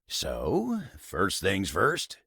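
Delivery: normal talking voice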